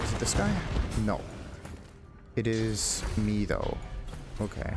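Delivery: ordinary delivery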